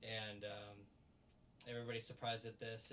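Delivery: ordinary talk, nothing marked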